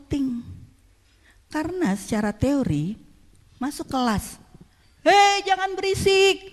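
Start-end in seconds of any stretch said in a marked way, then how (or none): 5.1-6.4 teacher shout. Retold or imitated. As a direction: bark it over the noise. Teacher entering noisy class.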